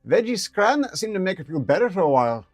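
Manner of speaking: high, lively ferret voice with a rough edge. Acted. Ferret explaining owner's cooking, high and lively. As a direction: play the pet ferret, chatty and high. Ferret voice telling about Eve.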